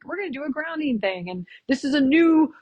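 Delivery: mild mock of a gushing presenter; mocking the host. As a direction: parody an overexcited workshop host. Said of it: "the woman was like".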